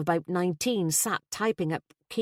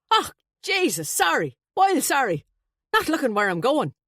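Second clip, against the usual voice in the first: loud, high startled apology; alarmed; 8 semitones higher, 4 dB louder than usual. A narrator's reading. Flustered best man nearly colliding with guests, alarmed. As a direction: blurt it out, jumping in pitch. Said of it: "looked up in alarm".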